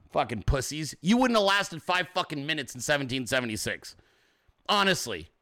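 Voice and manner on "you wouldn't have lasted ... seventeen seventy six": ordinary delivery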